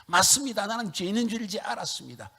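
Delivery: loud, high pleading confession; repentant lament. Retold or imitated. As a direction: confess with rising grief. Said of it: "애통함이 회개하는데".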